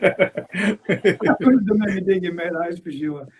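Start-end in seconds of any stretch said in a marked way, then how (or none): 0.0-2.4 laughing. Real, not acted. Laughing at a joke about digging.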